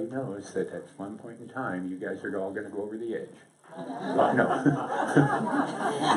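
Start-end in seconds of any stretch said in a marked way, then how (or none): 3.8-6.1 laughing mock dread.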